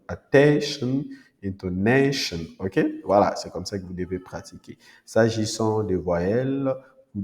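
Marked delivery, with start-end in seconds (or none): 0.1-2.4 attention, intonation with TEN and NA leaned on; stress on second-last syllable. Shown as a demonstration. Teacher giving -tion examples, stressed TEN and NA in attention, intonation. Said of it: "on met aussi l'accent tonique sur l'avant-dernière syllabe".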